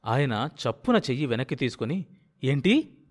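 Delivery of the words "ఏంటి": surprised. A narrator's reading. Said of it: "తను సరిగ్గా వినలేదేమో అని".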